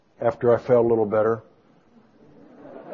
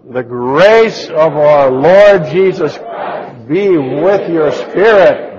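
loud, drawn-out recitation; spirited verse reading; 7 dB louder, much slower than usual. Real, not acted. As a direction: proclaim the verse slowly and forcefully. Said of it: "let's read it with our spirit".

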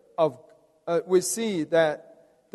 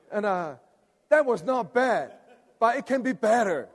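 ordinary talk, nothing marked

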